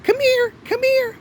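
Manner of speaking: high-pitched coaxing call to a pet; dog-calling voice. Retold or imitated. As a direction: imitate her sweet high voice for the dog. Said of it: "but changing her voice".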